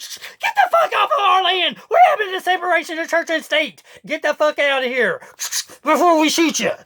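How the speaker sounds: high, laughing mock yell at the police; mocking armed deacon. Deacons telling police to leave, mock yelling. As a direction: play a church guard chasing police off.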